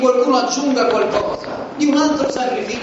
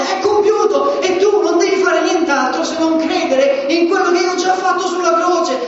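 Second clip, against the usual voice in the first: Gesù che grida vittoria, voce alta e cantilenata; Jesus crying out; 8 semitones higher than usual. Acted. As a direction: proclaim victory as Jesus, full force. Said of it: "il grido di Gesù che ti dice".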